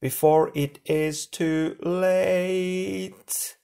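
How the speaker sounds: very slow, high, warped voice; distorted voice. A narrator's reading. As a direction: let the voice warp as it is crushed. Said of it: "its voice distorted by the weight".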